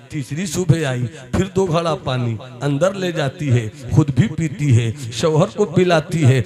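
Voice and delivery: rising storytelling. A narrator's reading. Storyteller to the gathering, building intensity. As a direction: build the tale toward a climax.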